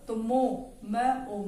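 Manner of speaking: ordinary talk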